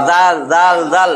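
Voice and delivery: "দাল" with a crisp d and the tongue touching the teeth; Arabic letter dal. Shown as a demonstration. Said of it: "জিহ্বার আগা এই দাঁতের গোড়ার সাথে".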